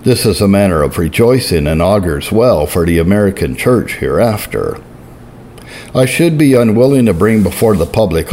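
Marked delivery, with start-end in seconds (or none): none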